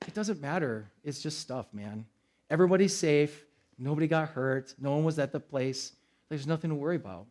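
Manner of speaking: soft, low soothing calm; calm reassurance. Retold or imitated. Pastor calming his family after the robbery, soft soothing voice. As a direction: settle everyone down gently. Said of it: "I'm like".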